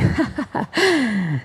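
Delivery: loose mocking cackle in an odd voice; imitated drunken laugh. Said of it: "laughing, saying".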